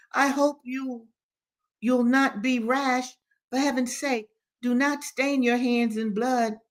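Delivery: high, earnest wifely caution; admonishing lady. A narrator's reading. Lady urges her husband not to be rash, admonishing. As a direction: plead in a high, careful lady's voice. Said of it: "admonished his lady".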